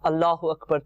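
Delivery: ordinary talk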